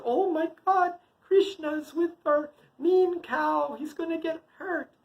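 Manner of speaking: high-pitched acted panic; frightened mother. Mother fears the mean cow will hurt her child, high dread.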